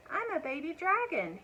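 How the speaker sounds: high, sweet little dragon voice; a small dragon explaining himself to the cows; baby dragon. A narrator's reading.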